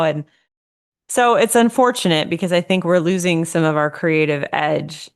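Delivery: ordinary talk, nothing marked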